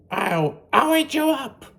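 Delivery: put-on high, loud cheeky boy threat; defiant kid yelling at his mother; Max defiant. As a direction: snap back like a naughty little boy. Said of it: "And Max said".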